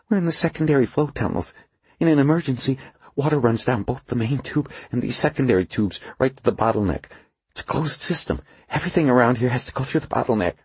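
a frightened worker explaining fast; nervous engineer voice. A narrator's reading. Narrator voices Duffy.

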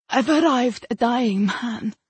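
usual speaking voice

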